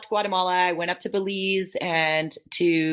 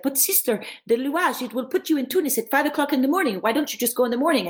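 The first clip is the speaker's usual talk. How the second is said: put-on higher, pleading voice of the host girl; Tunisian host girl; 8 semitones higher than usual. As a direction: coax her to wait till morning. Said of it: "the girl that I was staying with was like".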